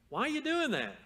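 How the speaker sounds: high, loud mock disbelief; baffled onlooker. Onlooker questioning someone stabbing himself, loud high-pitched bafflement. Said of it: "an imaginary dialogue where you meet someone".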